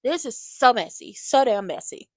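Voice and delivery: loud, exasperated so damn messy; exclaiming loudly. Real, not acted. Recapping soap drama, exclaiming loudly. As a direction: call out the mess.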